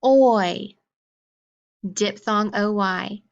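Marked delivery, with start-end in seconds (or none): 0.0-0.8 "oi" said alone, loud and drawn out; isolating the "oi" in toy; oi sound. Shown as a demonstration. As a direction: stretch out "oi" as a single vowel sound. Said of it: "So we have toy".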